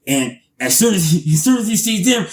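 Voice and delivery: normal talking voice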